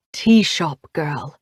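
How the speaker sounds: a hint of a smitten young man; awestruck Oliver. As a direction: breathe it out in stunned awe. Oliver stunned, low slow.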